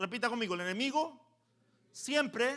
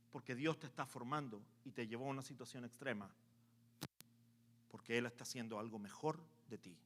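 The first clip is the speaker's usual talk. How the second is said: soft aside; 9 semitones lower, 15 dB quieter than usual. Acted. Preacher drops into intimate aside.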